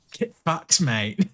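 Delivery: cheeky mock Australian retort; light Australian put-on, punchy; Australian accent. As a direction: fire it back like a brash Australian. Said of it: "she's just so Australian".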